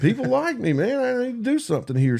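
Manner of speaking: high, quick amazed thought; sudden delighted realization; surprised realization. Retold or imitated. Retold surprised thought, high pitch.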